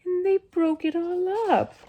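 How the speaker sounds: very high little bear cub; upset storybook baby bear, small and high; wee small Baby Bear voice. A narrator's reading. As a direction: cry out in a tiny, high voice. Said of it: "baby bear in his wee, small voice".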